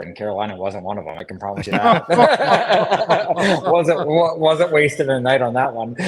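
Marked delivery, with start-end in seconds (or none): 2.1-3.6 loud laugh. Real, not acted. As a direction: burst of high laughter. Big laugh after hungover joke.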